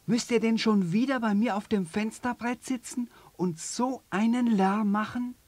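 hoch, genervt schimpfend; ärgerlich. Acted. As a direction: schimpf die Vögel an. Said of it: "Ärgerlich steigt es aus dem Bett". (genervt schimpfendes Känguru)

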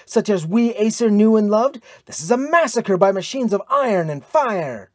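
high, forceful denunciation; impassioned outburst. A narrator's reading. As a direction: burst out in protest. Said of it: "burst out Brynhild".

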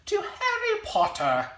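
hammed-up mock British accent; playful, terrible attempt at a British accent; terrible put-on accent. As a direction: do a badly faked British accent. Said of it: "That was a terrible accent".

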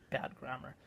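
ordinary talk

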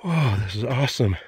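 ordinary talk

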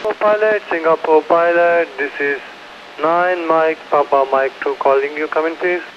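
marine radio. Real, not acted. Ship calls pilot station.

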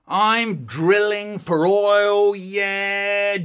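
loud, high, drawn-out drilling cry; playful roar. Acted. Boy pretending to drill into shaving soap, noisy play. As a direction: stretch it out like a droning drill.

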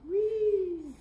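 high squeal of delight; sky-high playful whee. Acted. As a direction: shriek it like a kid on a slide. Little dog sliding down.

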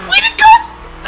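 sudden high yell of disbelief; shocked shout. Real, not acted. Gamer spotting a car out of nowhere, shocked shout.

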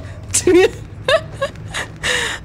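high, squeaky laugh that sounds like crying; helpless high wheeze of laughter; laughing to tears. Real, not acted. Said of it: "that's not crying".

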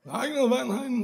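put-on high, soft slurred drunk voice; drunk Liam. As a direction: Liam noticing, sloshed and puzzled. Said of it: "Sådan, Liam lyder, når han er fuld".

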